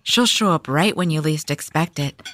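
high, sugary 1950s housewife gossip; chirpy churchy housewife chatter. Acted.

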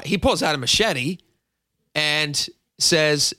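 ordinary delivery

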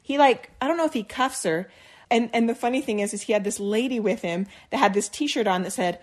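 ordinary delivery

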